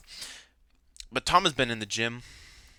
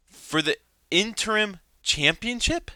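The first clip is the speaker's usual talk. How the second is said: stunned disbelief; 8 semitones higher, slower than usual. Real, not acted. Repeats in disbelief.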